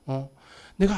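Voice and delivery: normal talking voice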